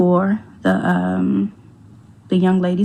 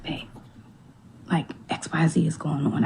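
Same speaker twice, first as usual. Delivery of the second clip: breathy unvoiced whisper, much softer; whispering to husband; 11 dB quieter than usual. Wife whispering the news to her husband at night, hushed. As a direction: hush it like pillow talk.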